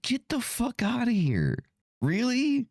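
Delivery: high-pitched disbelief, mocking the comment; incredulous scoff. Reading a stupid comment, mock incredulous scoff. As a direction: scoff at it like it's absurd. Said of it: "I'm like".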